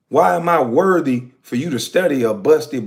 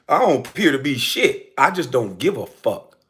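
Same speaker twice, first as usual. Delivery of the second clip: usual speaking voice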